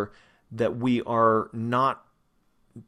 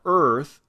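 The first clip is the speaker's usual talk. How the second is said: "earth" louder with a strong r-colored vowel; stressed earth; 4 semitones higher, 8 dB louder than usual. Shown as a demonstration. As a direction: land firmly on "earth". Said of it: "you can hear that stress".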